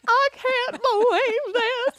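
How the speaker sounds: high, loud, slow mock wail with a laugh; wailing Becca. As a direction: imitate her breaking down, laughing through it. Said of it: "So then Becca's like".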